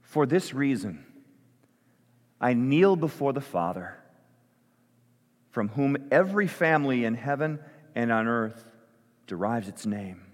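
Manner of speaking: slow, deliberate prayer with long pauses; slow spoken prayer. Real, not acted. Pastor praying Paul's words over the church, slow prayer. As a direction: pray it slowly, leaning on the key words. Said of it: "I'm gonna say it slowly".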